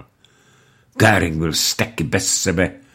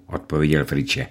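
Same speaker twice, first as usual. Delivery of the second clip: usual speaking voice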